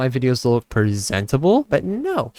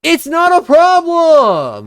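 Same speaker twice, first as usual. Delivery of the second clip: much higher, louder and slow, dragged-out protest; indignant outburst; 12 semitones higher, 6 dB louder, much slower than usual. Real, not acted. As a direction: drag it out in exasperated disbelief. Arguing with old narration.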